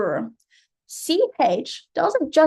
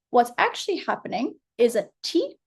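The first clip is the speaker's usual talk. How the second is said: normal talking voice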